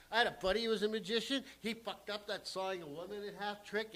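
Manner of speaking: ordinary talk, nothing marked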